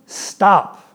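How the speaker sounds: stern dad's loud "Stop". Retold or imitated. Father silencing begging son, stern loud command. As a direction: cut the kid off like a firm father. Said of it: "You hear my dad voice?".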